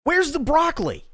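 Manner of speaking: high, loud annoyed demand; mock angry customer; perturbed demand. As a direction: snap it out, fed up with asking. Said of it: "only this time she's really perturbed".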